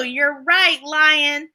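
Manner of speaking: plain reading voice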